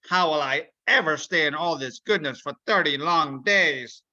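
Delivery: scheming villain, gleeful and sly. Acted. Mister Mean plotting to spoil Ramadan, scheming villain.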